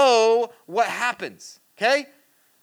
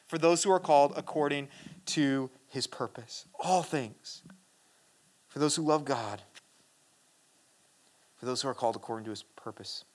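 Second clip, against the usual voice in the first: hushed; 7 semitones lower, 8 dB quieter than usual. Acted. Preacher quietly dwelling on Romans to the congregation, hushed. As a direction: lower and much softer, intimate.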